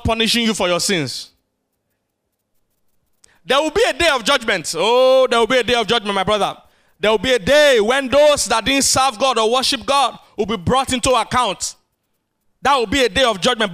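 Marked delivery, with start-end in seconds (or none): 3.4-13.7 louder, rising preacher's cry; fiery preaching. Acted. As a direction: thunder the warning to the church. Preacher building to full force.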